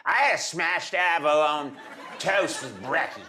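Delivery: overdone learner's Australian accent; unsubtle Australian accent. Shown as a demonstration. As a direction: lay the Australian accent on thick. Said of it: "it's lacking a little subtlety".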